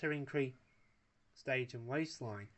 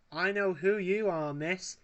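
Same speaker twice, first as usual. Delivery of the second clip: louder, eager Wallace voice; excited; 6 semitones higher, 7 dB louder than usual. A narrator's reading. Wallace excitedly recognises the Bakelite girl. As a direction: blurt it out starstruck. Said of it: "said Wallace".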